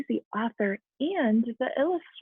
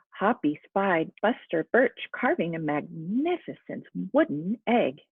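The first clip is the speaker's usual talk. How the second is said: usual speaking voice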